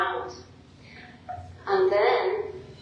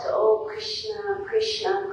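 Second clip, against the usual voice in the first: longing call; 13 semitones higher than usual. Retold or imitated. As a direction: call out to the beloved, high and yearning. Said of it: "calling out".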